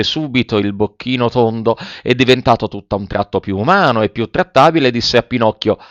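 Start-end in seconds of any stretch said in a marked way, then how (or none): none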